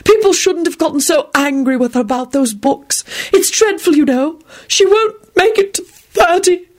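high-pitched, doom-laden mystic; sympathetic. A narrator's reading. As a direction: lament with theatrical pity. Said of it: "sighed sympathetically".

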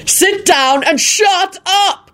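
high, slow, drawn-out shout; shouted order. A narrator's reading. Father shouting at rowdy son at dinner, slow and high. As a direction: drag out each word of the angry order. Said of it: "Quentin shouted at him".

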